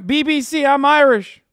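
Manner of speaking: high, loud hammed-up protest; mock indignation. Streamer jokingly protesting the BBC to chat, high loud mock offense.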